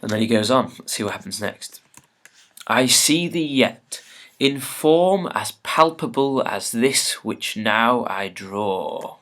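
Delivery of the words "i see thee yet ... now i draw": slow, raised Shakespearean declamation; dramatic verse reading. Acted. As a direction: open loud and high, then settle on the last words. Reads Macbeth's speech aloud.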